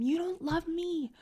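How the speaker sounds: high, slow, wailing you-don't-love-me; mock teary accusation. Wife retelling crying at husband, high slow wail. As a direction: whimper it like mid-fight tears. Angry crying fight retold.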